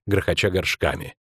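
usual speaking voice

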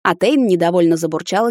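ordinary delivery, nothing marked

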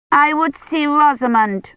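put-on high, stubborn child voice; a small girl demanding her way; insistent little girl. A narrator's reading. Agnes insists.

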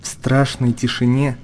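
normal talking voice

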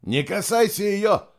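high, slow urgent shout; warning shout. A narrator's reading. Nicholas warning the girl off the water, shouting. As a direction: shout a warning to stop her. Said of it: "предупреждающе крикнул Николас".

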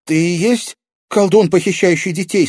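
put-on high, loud, stammering girl; alarmed young heroine; horrified question. A narrator's reading. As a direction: ask it in dread, stumbling on the first word. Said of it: "Катерина в ужасе вертела головой".

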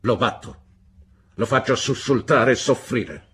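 loud, forceful stepfather's threat; menacing threat. A narrator's reading. Stepfather tells David how he breaks stubborn animals, menacingly. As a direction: hammer each threat home, loud and relentless.

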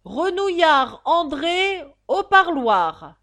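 high, loud, drawn-out crier's call; town-crier bark with stretched vowels; drawling roll-call shout. Acted. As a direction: bellow each name slowly to a crowd. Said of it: "continuait sur le même ton".

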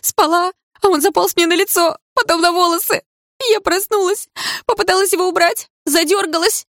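frightened. Acted. Girl scared by spider.

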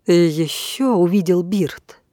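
ordinary delivery

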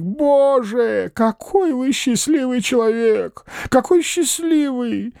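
high, wistful, slowing questions; envious old man. A narrator's reading. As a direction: marvel at his lucky dreams. Old man's envious wonder.

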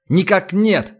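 lieutenant's abrupt, distinct denial; sudden, crisp reply. A narrator's reading. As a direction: answer the commander firmly and clearly. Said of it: "внезапно чётко сказал Титов".

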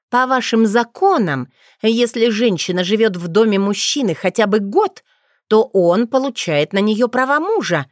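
angry accusation. Acted. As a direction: lay it out furiously. Witch exposing his scheme angrily.

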